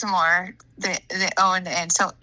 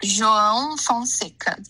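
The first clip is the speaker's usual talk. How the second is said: "João Fonseca" drawn out, each part clearly separated; slow demonstration. Shown as a demonstration. Teacher says the player's name on request, slowly. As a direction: pronounce "João Fonseca" slowly and carefully for a learner. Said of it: "Can you say it a little bit faster?".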